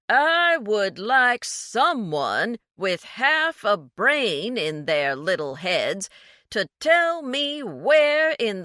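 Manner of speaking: condescending snob over the phone, slow and loud; excruciatingly slow, condescending. A narrator's reading. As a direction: spell it out as if to a fool. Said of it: "James spoke excruciatingly slowly".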